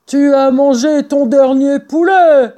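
high, loud character shout; yelled threat. A narrator's reading. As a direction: yell it as an angry farmer. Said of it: "hurlait Boggis". (hurler une menace)

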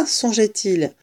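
plain reading voice

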